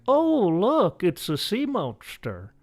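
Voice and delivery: high put-on old-person voice, slowing; exaggerated elderly wonder; mock older audience. Older audience spotting a sea monster, mock amazed. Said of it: "the older audience is gonna be like".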